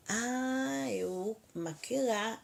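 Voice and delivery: ordinary talk, nothing marked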